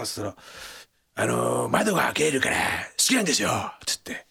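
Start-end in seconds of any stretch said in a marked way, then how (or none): 1.2-3.5 lightly imitated low mumble; a hint of Abe's low murmur; Abe impression. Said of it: "今、安倍さんですか？".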